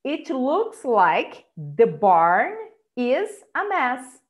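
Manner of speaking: "It looks like the barn is a mess" said slowly; slow model sentence. Shown as a demonstration. Teacher models the barn sentence slowly. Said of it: "Eu posso falar assim".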